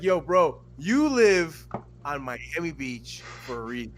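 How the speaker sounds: loud, slow telling-off; loud scolding. Retold or imitated. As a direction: slap down the bragger, loud and slow. Mocking rebuttal to bragger.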